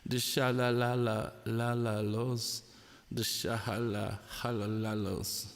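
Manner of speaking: ordinary delivery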